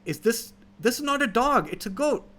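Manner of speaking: a hint of a flustered old man; insistent old farmer. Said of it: "he's like".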